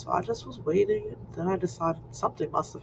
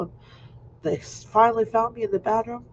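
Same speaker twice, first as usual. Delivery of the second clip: ordinary delivery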